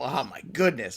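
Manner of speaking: ordinary delivery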